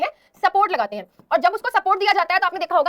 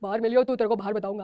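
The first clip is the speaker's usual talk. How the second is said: put-on lower, heavier voice of a teenage tough; deep teen boy voice; 9 semitones lower, 6 dB quieter than usual. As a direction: threaten like a boy whose voice just broke. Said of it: "तो आवाज भारी हो गई". (भारी आवाज़ में लड़के की धमकी)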